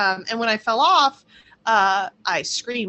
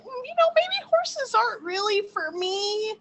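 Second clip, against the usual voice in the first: hesitant inner voice; 12 semitones higher than usual. Retold or imitated. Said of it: "I started being like".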